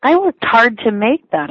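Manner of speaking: put-on guilt-trip the kid with a martyred snap; irritated mother's snapped retort; snapping. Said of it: "I snap at him".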